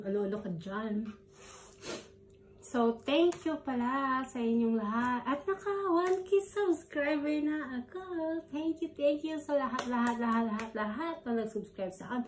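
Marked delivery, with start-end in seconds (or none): 2.7-12.2 excited thanks. Real, not acted. Thanking subscribers for 1K.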